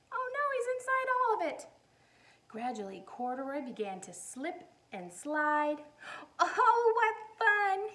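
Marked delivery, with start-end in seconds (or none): 0.1-1.7 high, fast oh no; playful alarm for the kids; mock dismay. Reader reacts to Corduroy buried in soap, high mock dismay. 6.4-7.9 high, loud delight. Acted. Corduroy sliding in soap flakes, high and delighted. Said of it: "he said with a smile".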